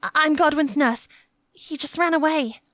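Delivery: a scared nurse stuttering to a knight. A narrator's reading. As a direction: trip over the first word in fear. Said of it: "Alwyn stuttered".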